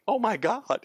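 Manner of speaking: a high, stunned gasp of wonder; amazed. Retold or imitated. Doctor seeing the changed boy, stunned and amazed. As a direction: let the surprise lift your voice up. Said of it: "I'm like".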